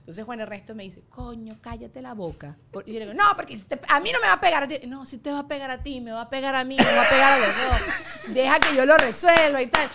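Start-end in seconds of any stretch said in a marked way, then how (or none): 1.1-2.6 husband's hushed rebuke. Retold or imitated. Husband telling his wife to stop, hushed rebuke. 3.2-9.9 raised, higher mock protest; heated retort. Wife insisting to her husband she'll handle it, heated retort.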